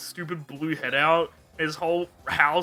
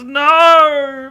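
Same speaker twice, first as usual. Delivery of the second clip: loud high cry of no; a big hammed-up scream; mock villain scream; 10 semitones higher, 15 dB louder than usual. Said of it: "He goes".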